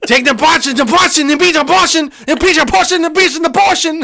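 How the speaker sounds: mock rapper, building up. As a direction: ham up a rapper's rising chant. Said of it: "That's what it sounds like".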